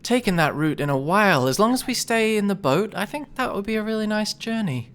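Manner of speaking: very high, dreamy charmed voice; charmed boatman. Acted. Charmed boatman agreeing to the trip, dreamy. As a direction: go high and starry-eyed.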